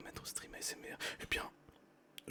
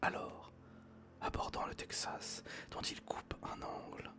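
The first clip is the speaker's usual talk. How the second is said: whispered story reading; 11 semitones lower than usual. Acted. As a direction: read the story gently in a whisper. Reads Jules Verne aloud whispering.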